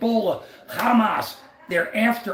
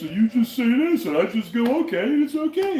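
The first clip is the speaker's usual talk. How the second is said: mocking bragging friends; 5 semitones higher than usual. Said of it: "our friends, they talk big".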